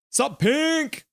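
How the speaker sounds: high-pitched "sup" to a friend; excited greeting. Real, not acted. Streamer hailing a returning viewer, excited greeting. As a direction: greet them in a high, playful call.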